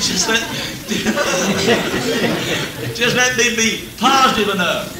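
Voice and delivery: prayer broken up by laughter; laughing while retelling his own prayer; laughing through a prayer. Retold or imitated. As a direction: chuckle your way through the prayer. Preacher quoting his own prayer.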